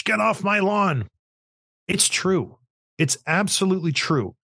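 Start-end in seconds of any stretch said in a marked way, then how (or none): 0.0-1.1 slow, mock-yelled old guy on the porch; hammed-up porch grump yelling; grumpy old man. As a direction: shoo the kids away like a cranky grandpa. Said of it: "I sound like a old guy on the porch".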